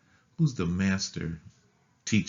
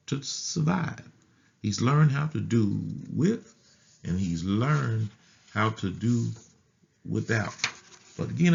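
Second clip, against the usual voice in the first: rising sermon fervour; 4 semitones higher than usual. Acted. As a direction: climb into full preaching voice. Preacher building to a peak.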